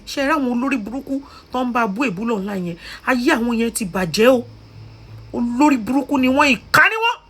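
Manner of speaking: loud, high, rising tirade; angry denunciation. Real, not acted. Vlogger cursing the online abusers, angry denunciation. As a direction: build to a high shout of condemnation.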